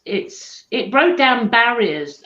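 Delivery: normal talking voice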